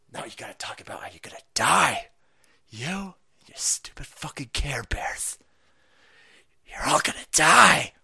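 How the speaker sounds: menacing whispered threat, slowed; mock creepy whisper. Teacher whispering doom to the scared kids. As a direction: hiss it to the kids like a horror villain.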